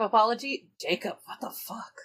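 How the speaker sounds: ordinary talk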